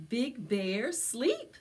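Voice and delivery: ordinary delivery, nothing marked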